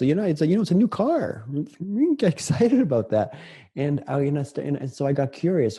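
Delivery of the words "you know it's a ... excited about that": high-pitched mock bafflement, breaking into a laugh; retold perplexity, voice lifted high with amusement; imitating perplexed wife. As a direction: play the puzzled wife, much higher and a bit louder. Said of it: "a little perplexed".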